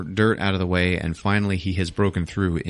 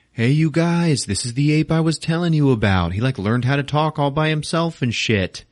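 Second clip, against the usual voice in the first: mock-eager, higher voice; eager introduction; 6 semitones higher than usual. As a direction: introduce a new pal to the group, pitched up. Ape excitedly introduces newcomer to group.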